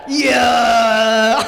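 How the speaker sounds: loud triumphant yell, hammed up; mock shouted cheer. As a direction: bark out the win. Shouted punchline.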